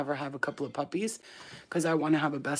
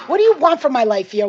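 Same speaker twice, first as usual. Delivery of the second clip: loud, high-pitched yell; raised voice, fed up; exasperated shout; 7 semitones higher, 11 dB louder than usual. Real, not acted. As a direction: holler at the pestering dog. Calling out at the pestering dog.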